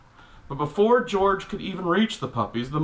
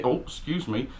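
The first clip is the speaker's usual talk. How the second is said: ordinary talk, nothing marked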